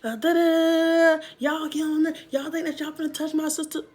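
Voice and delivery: put-on high, far-off yelling at the girls; retold yelling. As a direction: yell it at them from across the yard. Retold shouting at the sister.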